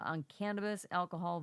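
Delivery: usual speaking voice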